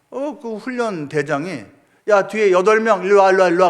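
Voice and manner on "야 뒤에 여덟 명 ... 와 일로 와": loud barked order to come over; shouted command; drill sergeant shout. Retold or imitated. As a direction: call the squad over like a training chief. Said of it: "그러는 거예요".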